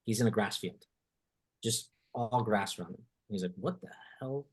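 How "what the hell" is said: soft, bewildered under the breath; stunned mutter. Retold or imitated. Walker suddenly lost in a field, stunned soft mutter. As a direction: mutter it in disbelief.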